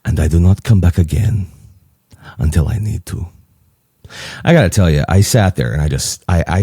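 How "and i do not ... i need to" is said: lower, solemn Greek impression; serious Greek accent. Father-in-law finishes his confession, serious Greek accent. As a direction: close gravely in a put-on Greek accent. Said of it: "he gets real serious".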